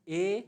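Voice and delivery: ए as a long, pure "eh"; umlaut sound. Shown as a demonstration. Teacher giving the sound of A umlaut, isolated vowel. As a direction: hold ए as one clear vowel. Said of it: "इनकी जो sound है वो होती है".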